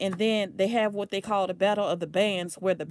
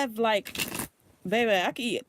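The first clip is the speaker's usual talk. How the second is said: ordinary talk, nothing marked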